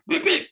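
high electronic beep noises; mock beeping. Imitating the sound desk.

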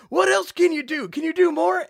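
high, loud eager teacher voice; excited teacher. Retold or imitated. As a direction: ask like a thrilled teacher. Teacher thrilled by impressions.

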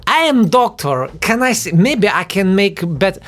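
very high mock Ukrainian pleading; fake Ukrainian accent. Brother argues with the policeman, high fake Ukrainian accent. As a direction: plead slowly in a squeaky put-on Ukrainian accent. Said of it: "to which my brother replied".

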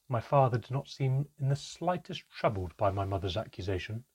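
plain reading voice

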